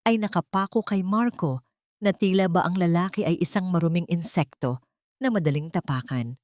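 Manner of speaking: ordinary delivery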